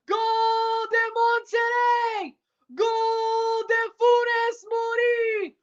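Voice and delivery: long sung goal cry, high and loud; goal call. Real, not acted. Commentator celebrating a goal, sung and drawn out. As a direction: belt out the goal for Monterrey.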